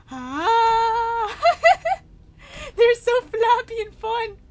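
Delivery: loud, high, slow through laughter; laughing gush. Real, not acted. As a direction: delight you can't hold in. Laughing at flappy corpses.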